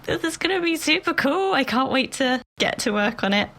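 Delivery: bright, high, eager; eager excited voice. Retold or imitated. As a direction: gush about the project. Imagined eager self-talk.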